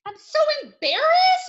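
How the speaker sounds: high, loud embarrassed wail; hammed-up loud embarrassment. Shown as a demonstration. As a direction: exclaim it high, mortified. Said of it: "Those are emotions".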